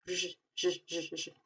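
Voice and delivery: "j" as a buzzing zh; J sound drill. Shown as a demonstration. Teacher drills the zh sound. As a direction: repeat "j" as zh, fading soft. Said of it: "se pronuncia siempre como j".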